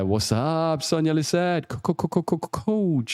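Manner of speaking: high, teasing shout-out without extra volume; playful greeting. Real, not acted. Greeting a viewer in the chat.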